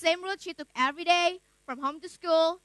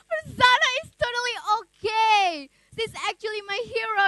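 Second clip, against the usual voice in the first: amused excitement; 5 semitones higher, 4 dB louder than usual. Acted. Laughing friend reassures her.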